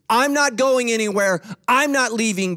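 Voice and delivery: high, loud insistence; emphatic declaration. Retold or imitated. Pastor reassuring congregation he's staying, emphatic declaration.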